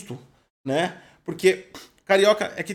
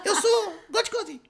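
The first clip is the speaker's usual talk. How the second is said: high, laughing put-on Japanese voice; stiff, formal fake Japanese intro; mock Japanese accent introducing himself; 13 semitones higher than usual. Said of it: "o japonês fala com a coluna".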